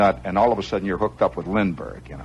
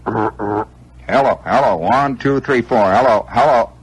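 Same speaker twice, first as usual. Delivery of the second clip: loud, raised test calls; mic test call; 7 dB louder, slower than usual. Retold or imitated. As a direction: call out into a dead microphone. Acting out a microphone test.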